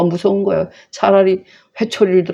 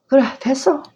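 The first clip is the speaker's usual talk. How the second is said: much higher, curt mock approval; a father's contemptuous "enough"; sarcastic dismissal; 8 semitones higher than usual. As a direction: wave him off with scorn. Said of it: "비아냥거리는 거죠".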